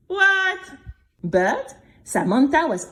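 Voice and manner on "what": high, startled what; shocked what. A narrator's reading. Narrator to listener, shocked disbelief. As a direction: gasp it out in disbelief. Outraged exclamation.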